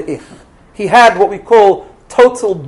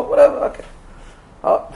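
ordinary talk

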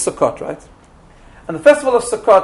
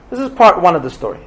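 normal talking voice

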